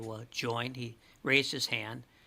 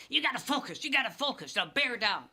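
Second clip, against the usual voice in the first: high, pushy coach pep talk; intense coach; 11 semitones higher than usual. Retold or imitated. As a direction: bark it like a hyped Little League coach. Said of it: "the other coach would say".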